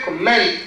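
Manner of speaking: "Comment" stressed on the second syllable, com-MENT. Shown as a demonstration. Teacher models the verb for the class, "Comment" stressed com-MENT. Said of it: "This is verb".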